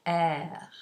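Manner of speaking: "air" ending in a light fricative French R. Shown as a demonstration. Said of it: "normally you would use the fricative phone".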